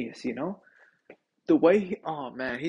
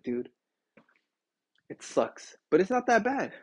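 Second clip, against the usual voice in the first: ordinary talk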